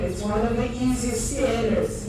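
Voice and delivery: ordinary talk, nothing marked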